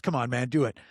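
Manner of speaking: ordinary talk, nothing marked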